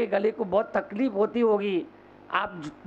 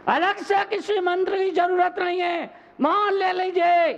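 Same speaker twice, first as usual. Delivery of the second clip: high, sustained exhortation; full-force preaching; 9 semitones higher than usual. Real, not acted. Preacher urging families to take up silence, full-force preaching. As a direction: push the pitch way up and ring it out.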